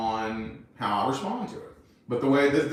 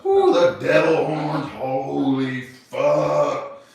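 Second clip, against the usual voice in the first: mock dread; 3 semitones higher, 7 dB louder, much slower than usual. Teasing about her PMS moods.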